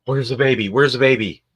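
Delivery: loud, high frantic cry; panicked shout. Acted. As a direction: play a mother waking up alarmed for the baby. Acted mother waking in a panic.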